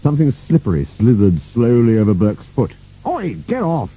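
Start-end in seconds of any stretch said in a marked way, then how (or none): 3.1-3.9 high-pitched cry, no added volume; mild shout. A narrator's reading. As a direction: shoo it off with an understated yell. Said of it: "he shouted".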